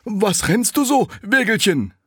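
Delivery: the dung heap asks the running cart, high and loud; little dung heap. Acted. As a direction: ask in a high drawn-out squeak. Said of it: "Da sprach das Misthäufelchen".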